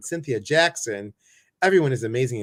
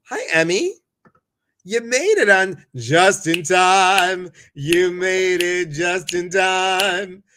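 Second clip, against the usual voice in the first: teasing greeting; 6 semitones higher, 7 dB louder, slower than usual. Real, not acted. Greeting latecomer.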